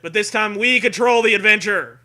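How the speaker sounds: loud, high trailer-voice read; announcer. Acted. Host hypes the audience like an announcer. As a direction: boom it like a book blurb announcer. Announcer-style book intro.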